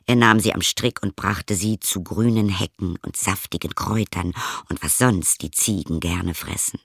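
usual speaking voice

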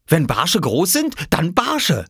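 put-on a boy weighing his answer seriously; earnest little boy. Oskar telling the farmer which fish he wants, earnest.

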